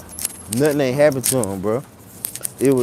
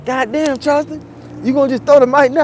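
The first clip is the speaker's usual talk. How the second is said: high, loud hype-man call; street star DJ voice; 13 semitones higher, 4 dB louder, faster than usual. Retold or imitated. DJ picking up the mic calling out Charleston, high and loud. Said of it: "He sound like the dude, like, real street star".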